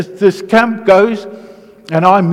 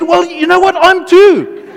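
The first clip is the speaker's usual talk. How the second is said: high and louder, laughing through it; laughing confession; 12 semitones higher, 5 dB louder than usual. Retold or imitated. To the guy addicted to porn, high laughing admission. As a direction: own up with a laugh.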